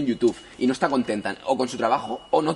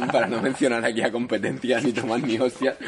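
laughing. Real, not acted. Jokes about cutting the name.

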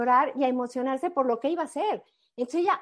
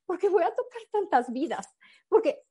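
tearful; 6 semitones higher than usual. Retold or imitated. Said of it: "¿Pero por qué lloras?".

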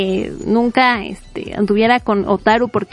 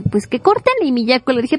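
normal talking voice

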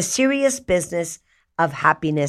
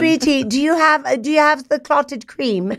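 high put-on British accent, amused; giggly imitation of British speech; mock British husband; 7 semitones higher than usual. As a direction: play a British spouse asking politely. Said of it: "he'll say things like".

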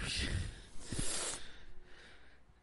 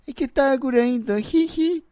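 high-pitched mocking imitation; mock tearful caller; 8 semitones higher, 5 dB louder than usual. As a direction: tease a friend with a squeaky whining version of his story. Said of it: "y el otro que llama". (imitación burlona y aguda)

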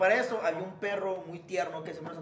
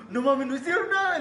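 excited retort; 7 semitones higher, 6 dB louder than usual. Retold or imitated. Retold laughing reply to friends.

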